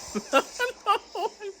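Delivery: short high disbelieving laugh; high exasperated laugh. Real, not acted. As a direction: laugh it off in frustration. Laughs at being abandoned.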